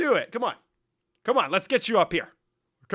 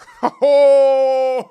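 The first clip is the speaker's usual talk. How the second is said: big high cackling laugh; a shrieking fit of laughter; loud laughing shriek; 9 semitones higher, 15 dB louder than usual. Real, not acted. Explodes laughing at own joke.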